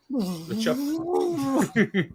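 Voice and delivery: high, very slow drawn-out "the chopper". Pretend. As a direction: stretch it out like a cartoon helicopter.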